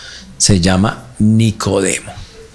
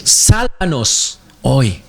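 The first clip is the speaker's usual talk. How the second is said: "Sálvanos hoy" spoken very slowly and weightily, each word drawn out; slow solemn translation; much slower than usual. Shown as a demonstration. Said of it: "¿ustedes saben qué significa Hosana?".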